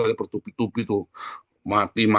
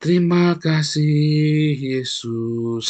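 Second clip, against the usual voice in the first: drawn-out, louder prayerful thanks; a pastor leads a grieving friend in prayer, slow and fervent; 4 semitones higher, 3 dB louder, much slower than usual. Shown as a demonstration. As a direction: pray each word slowly and fervently. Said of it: "yuk kita berdoa yuk".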